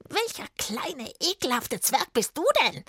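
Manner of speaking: high, sneering witch voice; cruel witch. A narrator's reading. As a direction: draw out the insult like a nasty hag. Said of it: "fragte die grausame Hexe".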